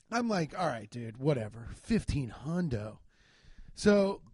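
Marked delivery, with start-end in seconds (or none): 0.6-3.0 low, softened giving-in; low, resigned mock shrug; retold resignation. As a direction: grudgingly accept it, pitched down. Giving in to the repair price.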